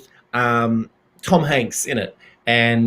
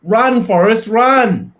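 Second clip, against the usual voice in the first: higher and louder, hammed-up call; Run Forrest quote; 6 semitones higher, 5 dB louder, slower than usual. Cheering a friend on in the film, hammed-up shout. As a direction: shout it like the famous movie line. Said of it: "And that was Forrest Gump".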